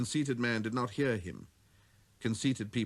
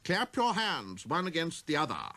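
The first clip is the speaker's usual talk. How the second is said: a pompous show-off giving orders; conceited man; 7 semitones higher than usual. Acted.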